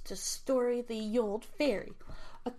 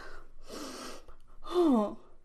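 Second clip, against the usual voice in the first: breathy gasp; 4 semitones higher than usual. Acted. Fairies hear the Sun King is dead.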